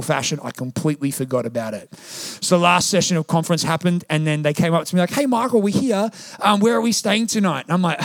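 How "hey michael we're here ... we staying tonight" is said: higher, bright mock arrival greeting; cheery guests. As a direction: chirp it like expectant visitors. Guests arriving expecting lodging.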